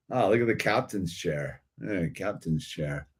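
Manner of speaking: usual speaking voice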